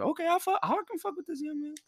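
put-on high, impressed older guy; old head voice. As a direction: nod along like an old-timer.

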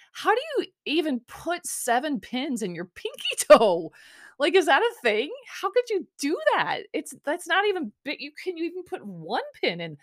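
high-pitched, amused disbelief; incredulous questioning. Retold or imitated. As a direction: ask it in high, baffled astonishment. Quotes own baffled question to friend.

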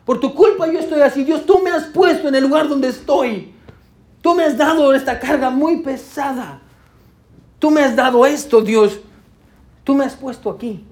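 psalmist blaming God. Acted. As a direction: accuse God as the suffering psalmist. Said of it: "el salmista echándole la culpa a Dios".

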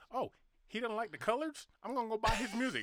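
high, eager mocking of America's fans; mock eager fan buying music. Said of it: "America's like".

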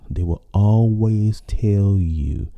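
ordinary delivery

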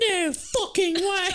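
high, loud, drawn-out put-on voice; hammed-up shriek of disbelief; mock disbelieving girl. Girl hears knight used to live here, mock astonishment.

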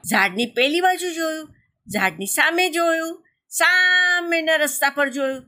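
high-pitched, stretched first word. A narrator's reading. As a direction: draw out "સામેના" high for distance. Narrator stretches the searching gaze.

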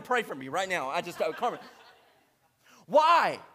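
ordinary talk, nothing marked

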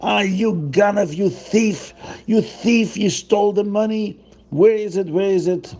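mock fury at the thief; accusing crowd. Townsfolk calling Zusha a thief, mock angry.

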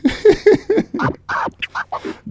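high, breathy cackle; real mischievous giggle; laugh. Real, not acted. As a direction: laugh at your own revenge joke. Laughs after joking about revenge.

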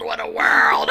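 low, rasping whispered wail; dramatic sick man. Retold or imitated. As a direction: groan it out in a low rasp. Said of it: "Willie gets sick".

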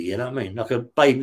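ordinary talk, nothing marked

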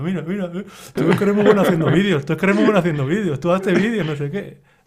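high, loud, smug mock praise with a laugh; condescending. Imitating Jota praising the host's videos, condescending and high. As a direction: pat him on the head with your voice. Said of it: "Con, con descendencia, ¿sabes?".

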